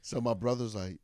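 ordinary talk, nothing marked

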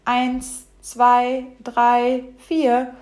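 steady chanted storybook counting. A narrator's reading. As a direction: call numbers out for hide-and-seek. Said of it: "fängt Fleckchen an zu zählen".

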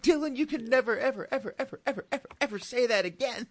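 high, loud pleading scold; hammed-up dad scolding his son; mock stern parent. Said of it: "And I'm like".

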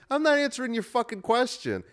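imitating the doctor hanging up on a salesman; mocking rude doctor. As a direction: mimic the smug doctor, cranked way up. Rude doctor retold on phone.

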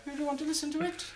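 very high, quick granny question; old lady voice. Pretend. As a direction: ask like a fussy old nan. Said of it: "What's your best old lady voice?".

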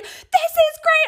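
very high, drawn-out elated squeal; excited screaming. Retold or imitated. Reacting to her paid-for drink, excited screaming. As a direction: squeal it slowly, sky-high with joy. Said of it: "screaming in my car".

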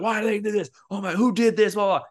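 high-pitched, hammed-up outrage; mock mad. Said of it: "So then you get all mad".

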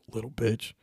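ordinary talk, nothing marked

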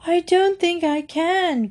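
high-pitched, worried little bear; upset cry. A narrator's reading. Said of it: "cried Paddington, looking most upset".

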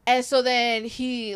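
normal talking voice

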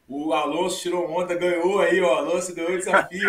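loud, laughing brag about a win; laughing teasing. Real, not acted. Teasing about shooting challenge.

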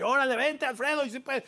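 rally him forward, calling his name; motivating cheer. Retold or imitated. Helper carrying his backpack urges Alfredo on, high rallying call. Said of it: "Te van motivando así".

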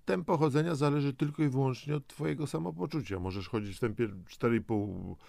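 ordinary talk, nothing marked

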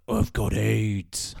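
very slow, low deadpan patient; mock-grim improv announcement; Liam Neeson. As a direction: drag it out in a grave, low voice. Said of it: "And he's like".

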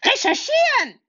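high put-on character voice, know-it-all; Rufus answering. Rufus correcting Mickel, high and prim. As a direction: correct them in a squeaky clever voice. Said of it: "antwortet Rufus".

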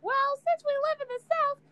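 high teasing imitation of a friend; mocking a friend. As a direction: squeak it out to tease her. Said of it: "You was the one who said".

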